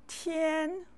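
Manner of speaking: "tian" held high and level; Mandarin first tone. Shown as a demonstration. Teacher showing learners the one first-tone word, "tian" high and level. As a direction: sing out "tian" on one steady high pitch. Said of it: "How many words use the first tone".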